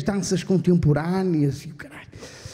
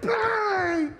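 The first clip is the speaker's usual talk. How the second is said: high shrieked ouch; 10 semitones higher, 3 dB quieter than usual. Retold or imitated. Acted cry of pain.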